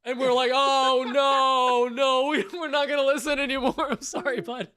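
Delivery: laughing. Retold or imitated. Laughing retelling of guard scene.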